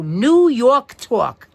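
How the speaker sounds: "New York Talk" stretched slowly in a broad New York accent, "tawk"; slow, loud New York drawl on "Talk" as "tawk". Shown as a demonstration. Teacher reads book topic to class in New York accent. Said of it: "N E W Y A W K".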